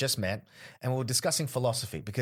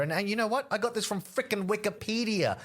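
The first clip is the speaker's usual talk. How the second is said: heated mock retort; 9 semitones higher than usual. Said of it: "imagined comeback to the heckler".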